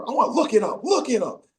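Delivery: high-pitched, repeated demand; mock insistence. Player calling a trainer over to check, high mock insistence. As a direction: insist on it with the voice jumped up.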